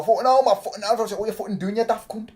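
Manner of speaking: high-pitched mock Geordie accent; Geordie impression. Streamer mimicking an annoying but funny Geordie, high mock Geordie accent. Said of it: "Geordie's annoying, but Geordie's not".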